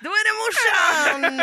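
high, loud, slow reveal with a laugh; shouted punchline. Real, not acted. To her friends, shouting the punchline through laughter. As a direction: belt out the twist.

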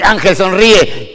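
a stern coach repeating himself, slow and loud; coach's insistent order. Retold or imitated. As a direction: spell out each word like a fed-up coach. Coach repeats order next day.

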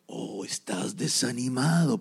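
tempting demon. Acted. Demon voiced, second try.

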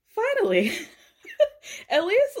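high, laughing finally; laughing relief. Real, not acted. Podcaster relieved that someone finally said it, laughing.

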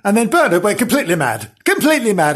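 ordinary delivery

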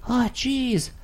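high mock Marty McFly voice; Marty McFly impression. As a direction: squeak it out like a nervous teen. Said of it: "sound like Marty McFly".

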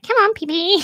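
high cooing pet voice. Retold or imitated. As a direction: coo at the dog, high. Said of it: "I'm like".

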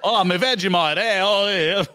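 playful fake Australian exclamation; mock Australian accent. Mocking Australians after clip.

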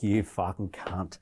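slow, disgusted curse at himself; self-directed curse. Retold or imitated. To himself after hitting SOS, a slow curse.

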